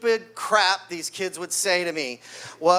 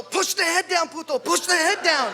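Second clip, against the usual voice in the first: high, shouted mat-side coaching; coach yelling; 8 semitones higher than usual. Retold or imitated. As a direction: bark instructions at your wrestler. Coach yelling instructions at wrestler.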